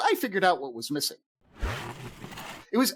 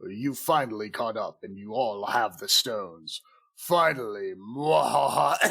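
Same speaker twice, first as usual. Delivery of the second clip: slow, lower, drawn-out villain gloating; ominous slow villain with a mock evil laugh; hooded villain; 7 semitones lower, slower than usual. Hooded figure greeting those who finally caught up, villainous.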